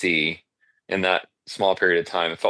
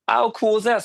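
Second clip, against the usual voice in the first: excited; 4 dB louder than usual. Retold or imitated. Thrilled at a surprise booking.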